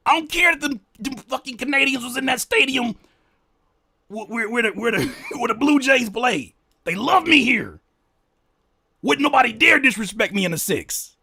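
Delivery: hammed-up imitation of a cocky star. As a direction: puff up and brag in a high mock voice. Mocking [name]'s inner voice.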